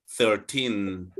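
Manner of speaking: put-on Thirteen with the weight on the final teen; teacher modelling Thirteen, end stressed; stress on EEN. Shown as a demonstration. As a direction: land firmly on the last syllable of Thirteen. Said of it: "your emphasis is in the E E N".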